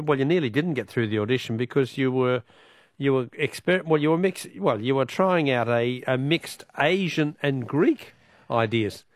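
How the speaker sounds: normal talking voice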